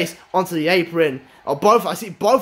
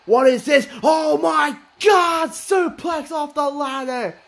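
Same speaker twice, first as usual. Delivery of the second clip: high, slow astonished yelling; real shocked yell at a wild spot; stunned shout; 7 semitones higher, slower than usual. Real, not acted. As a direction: scream it like you can't believe your eyes. Stunned by ladder suplex.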